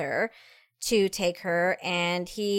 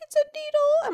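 put-on high small child voice; little girl voice. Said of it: "She's like".

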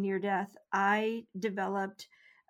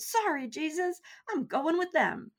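higher, cheeky smirk in the voice; 8 semitones higher than usual. Retold or imitated. Teasing Jesus before turning back to her body, high smirking tone. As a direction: say it with a playful smirk, pitched up. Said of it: "I kind of smirked".

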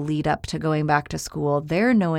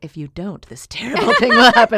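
half-laughing mock doom warning; ominous warning; 5 semitones higher, 9 dB louder than usual. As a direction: play up the threat, laughing. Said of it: "I'm like".

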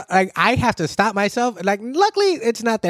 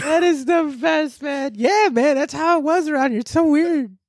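excited laughing; 6 semitones higher than usual. Real, not acted. Delighted reaction, laughing.

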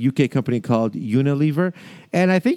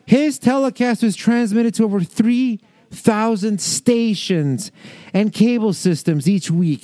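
slower, higher re-read in disbelief; amazed; 8 semitones higher, slower than usual. Real, not acted. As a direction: hammer out the figure, high and incredulous. Rereads the figure in disbelief.

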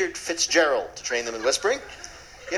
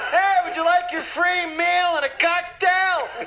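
much higher, slow gloating call; mock attendant; 4 dB louder, slower than usual. Mock first class attendant gloats toward coach, high slow call. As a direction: hawk it smugly like a vendor.

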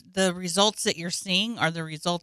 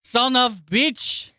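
put-on Russian accent; 4 semitones higher, 3 dB louder, slower than usual. Mock Russian insult.

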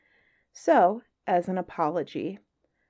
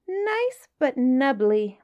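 high, slow, savoring little fish; small astute voice; 6 semitones higher, 6 dB louder, much slower than usual. Acted. Clever fish tempts the whale about man, high slow voice. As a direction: tempt him with a slow tiny treat of a voice. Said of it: "said the small 'stute fish".

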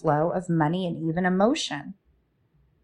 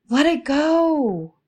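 slow release; 7 semitones higher, 3 dB louder, slower than usual. Shown as a demonstration. To listeners holding emotions, a slow, high, drawn-out release. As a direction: let it go, high and drawn out slowly. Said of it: "So when something comes up".

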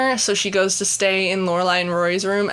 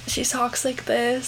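slow, softer mimicry of a quiet mother; imitating reserved mom; 3 semitones higher, 5 dB quieter, slower than usual. Daughter mimics her quiet mom for a friend, reserved. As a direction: impersonate a very reserved mom, slowly. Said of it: "I was gonna mimic her with you".

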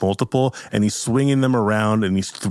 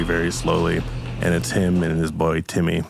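usual speaking voice

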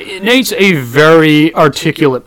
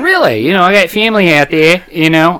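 put-on Australian accent; 3 semitones higher than usual. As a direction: imitate a friendly Australian making conversation. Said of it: "Remember the Australian guy".

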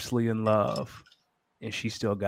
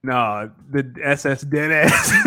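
ordinary talk, nothing marked

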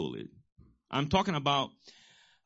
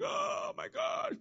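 mock childish crying; 8 dB quieter, slower than usual. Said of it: "And I was crying".

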